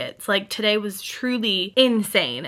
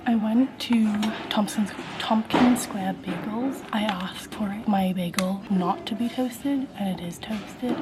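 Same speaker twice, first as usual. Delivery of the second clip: whisper; 4 dB quieter than usual. Real, not acted. Quiet talk in public.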